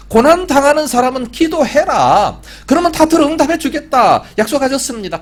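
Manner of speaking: high, loud pulpit proclamation; fervent preaching. Acted. Preacher urges the suffering to pray, fervent preaching. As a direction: declare scripture with force.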